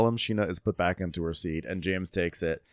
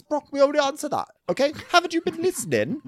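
very high, rapid squeal of impatience; hammed-up, high-pitched exasperated retort; mock exasperation; 3 dB louder, much faster than usual. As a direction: imitate a fed-up man in a squeaky rush. James snapping at Brock, retold.

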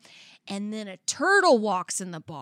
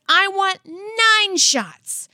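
higher, louder and very slow, a cartoon cat ordering; 9 semitones higher, 5 dB louder, much slower than usual. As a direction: drawl the order out like a smug cat. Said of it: "And the cat says".

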